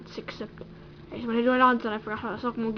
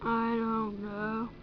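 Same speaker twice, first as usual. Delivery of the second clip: funny voice; 3 semitones lower, 9 dB quieter than usual. Acted. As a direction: drag it out, dopey and low-key. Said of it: "Stop talking like that".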